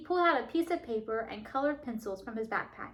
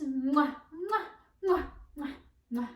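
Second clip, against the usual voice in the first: kissing sounds; 2 semitones higher than usual. Shown as a demonstration. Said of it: "movie star kisses".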